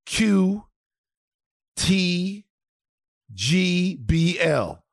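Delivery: letters spaced out one by one, very slowly; slowly backwards. Shown as a demonstration. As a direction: drag out each letter carefully backwards. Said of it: "Say it backwards".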